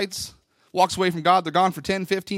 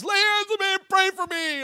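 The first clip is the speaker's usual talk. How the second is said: mock pleading; 9 semitones higher than usual. Man at the altar begs the preacher, mock wailing. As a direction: imitate a man wailing for prayer.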